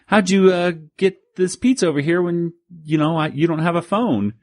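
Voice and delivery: put-on high, bewildered old inventor; puzzled inventor. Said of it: "Alexander Graham Bell says".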